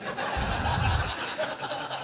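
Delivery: a long wheezing laugh with no voice; breathy silent laugh. Real, not acted. Remembering his teacher's joke, a silent wheezing laugh. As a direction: laugh soundlessly, shaking with it.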